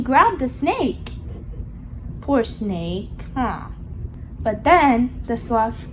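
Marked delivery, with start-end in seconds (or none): none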